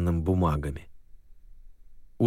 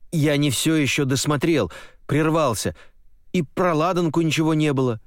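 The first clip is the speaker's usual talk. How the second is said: urgent explaining; 7 semitones higher than usual. Acted. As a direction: explain it in a rush.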